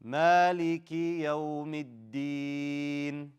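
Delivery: slow chanted verse, long held endings; Quran recitation. Shown as a demonstration. Teacher recites for class to repeat, slow chanted recitation. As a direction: recite it measured and drawn out.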